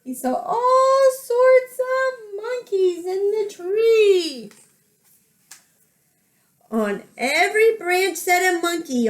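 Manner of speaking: high, drawn-out storytelling wonder; lively picture-book narration; animated children's story reading. A narrator's reading. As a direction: stretch the big words for small listeners.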